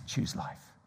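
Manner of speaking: "Choose life" whispered softly and slowly; whispered plea. Real, not acted. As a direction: breathe it as a gentle plea. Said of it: "hushed final exhortation".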